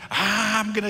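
loud, high mock battle cry; Goliath. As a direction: burst out loudly as a threatening giant, in imitation. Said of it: "Goliath got up and said".

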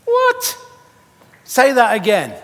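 loud, comically stressed squeal; mock panic, octaves up; high-pitched squeak. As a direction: start at a dolphin squeak, staying high and loud. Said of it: "my voice is so high-pitched".

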